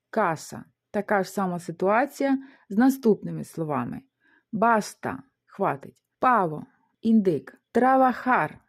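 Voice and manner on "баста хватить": ordinary talk, nothing marked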